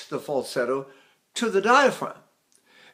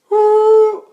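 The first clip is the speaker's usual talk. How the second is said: very high, much louder, slow ooh; slipped falsetto ooh; 12 dB louder than usual. Shown as a demonstration. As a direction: let the breath slip into falsetto. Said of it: "the breath slips and it goes to falsetto".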